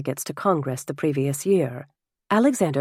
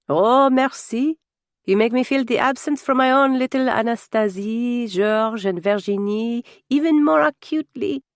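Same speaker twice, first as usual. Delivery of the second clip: put-on higher, effusive French-accented voice; a French father moved by a child's greeting; touched French nobleman; 6 semitones higher, 3 dB louder than usual. French guest thanks the boy, missing his own children, effusive and higher.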